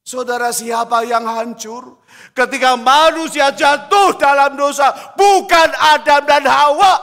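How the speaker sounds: loud, high pulpit cry; loud, high preaching; full-force preaching. Acted. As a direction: call it out to the whole church.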